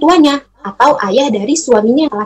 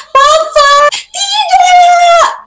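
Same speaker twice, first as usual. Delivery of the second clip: very high, loud mock melodrama shout; hammed-up wail; 4 dB louder, much slower than usual. Storyteller overplays the widow's grief, then takes it back, hammed-up wail. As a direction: overact the grief, soap-opera style. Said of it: "Enggak kayak gitu".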